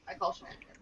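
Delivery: "I call shenanigans" whispered with no voice. Shown as a demonstration. Repeating the accusation to friends in a whisper. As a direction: breathe "I call shenanigans" as a hushed echo.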